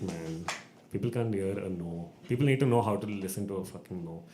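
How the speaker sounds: usual speaking voice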